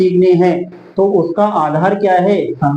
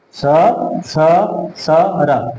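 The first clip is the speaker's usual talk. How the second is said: स, स, स, र with gaps between; one by one; much slower than usual. Shown as a demonstration. Teacher drilling the thirteenth sutra, letter by letter. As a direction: say each sibilant separately. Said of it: "एक साथ बोलेंगे तो".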